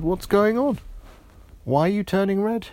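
high, loud alarmed question; startled friend. Acted. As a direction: gasp it out, worried and fast. Georgia alarmed by friend's colour.

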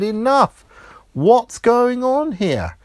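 loud, high, drawn-out exasperation; slow, high cry of fed-up frustration; exasperated outburst. Acted. Georgia fed up chasing straws round the cup, exasperated outburst.

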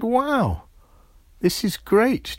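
high, loud joyful exclaim; delighted friend. Acted. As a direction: burst out with glee. Georgia turns blue too.

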